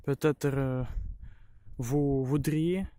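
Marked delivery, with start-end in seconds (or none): none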